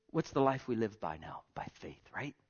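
soft unvoiced whisper, rising at the end; whispered question. Real, not acted. Preacher quizzes the church in a whisper. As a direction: tease it out like a secret.

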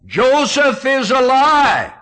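high, loud, drawn-out cry of joyful news; slow, ringing shout that Joseph is alive. Retold or imitated. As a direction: call it out at the top of your voice. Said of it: "they call out at the top of their voice".

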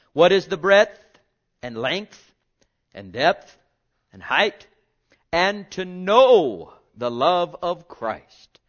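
loud, slow proclamation; preaching scripture. Acted. Preacher reading Ephesians to congregation, loud and slow. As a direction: call each word out to the pews.

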